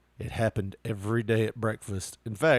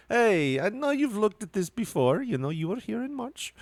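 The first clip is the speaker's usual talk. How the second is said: much higher, sing-song customer-service voice; chirpy hotel manager; 9 semitones higher than usual. Retold or imitated. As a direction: imitate a sugary sales manager greeting a guest. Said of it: "That's the way she talked".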